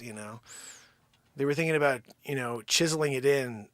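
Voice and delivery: normal talking voice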